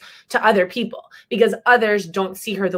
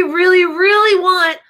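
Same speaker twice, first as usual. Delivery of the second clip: eager longing; 9 semitones higher, 5 dB louder than usual. Acted. Child longs for rhino horn.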